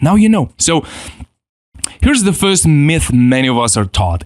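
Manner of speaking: ordinary talk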